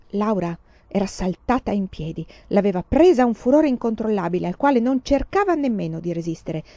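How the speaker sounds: ordinary delivery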